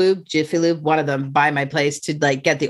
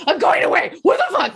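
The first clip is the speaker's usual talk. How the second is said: retold panic; 11 semitones higher than usual. Telling friends how she freaked out driving, high panicked laugh. Panicking over the car trouble.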